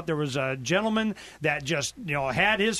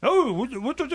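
higher-pitched, failed Scottish accent attempt; bad Scottish accent; 6 semitones higher than usual. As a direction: stumble through a hopeless Scottish accent. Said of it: "I can't even do a Scottish accent".

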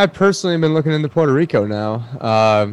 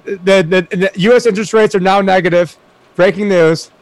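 higher-pitched, urgent announcement; excited breaking news; 6 semitones higher than usual. Real, not acted. Cohost interrupts with negative interest rates, excited. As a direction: blurt out real breaking news.

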